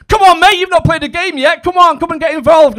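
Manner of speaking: high, loud, chirpy nagging; pushy holiday rep. Retold or imitated. Animator nagging the relaxing dad by the pool. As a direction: pester him into joining in.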